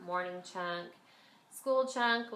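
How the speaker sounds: normal talking voice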